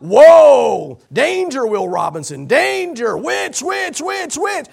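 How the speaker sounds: high, loud robot-style alarm call; mock alarm. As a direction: sound the alarm like a sci-fi robot. Mock alarm at spotting a witch.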